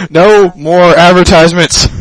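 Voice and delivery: loud, slow, drawn-out protest. Real, not acted. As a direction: boom it out in protest.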